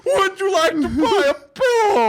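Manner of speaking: high, slow, strained wailing pitch; Chris Farley character. Retold or imitated. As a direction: overblown desperate salesman voice. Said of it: "Like a Chris Farley character".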